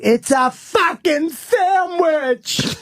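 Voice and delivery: high, slow, stretched-out scream; a wife finally losing it over a sandwich. Retold or imitated. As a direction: shriek it at him in full exasperation. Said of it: "she finally just screamed".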